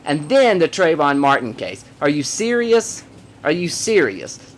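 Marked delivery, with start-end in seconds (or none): 2.0-3.0 higher and slow, in disbelief; incredulous. Real, not acted. Incredulous at the Trayvon Martin case. 3.4-4.5 exasperated. Real, not acted. Repeats it, flatter and quieter.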